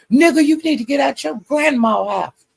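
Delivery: high, taunting jab; mock insult. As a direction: imitate the insult, pitched well up. Retold insult in an argument.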